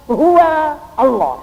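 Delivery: slow, loud and high, each word drawn out; solemn proclamation. Acted. Preacher proclaiming to the congregation, slow and loud. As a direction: proclaim the name to the crowd.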